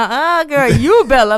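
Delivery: loud laughing uh-uh; laughing denial. Retold or imitated. Singer jokingly denying she is Bella, laughing. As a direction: deny it while laughing. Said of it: "I was like".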